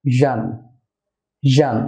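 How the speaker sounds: জান said "zhan", much like Arabic jim; mid-tongue zh in জান. Shown as a demonstration. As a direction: say জান with a buzzing zh. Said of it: "আরবি জিমের মতো অনেকটা উচ্চারণ হবে".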